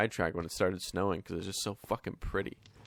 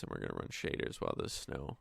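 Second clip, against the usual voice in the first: ordinary talk, nothing marked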